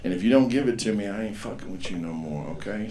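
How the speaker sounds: usual speaking voice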